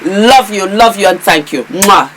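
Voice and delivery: ordinary talk, nothing marked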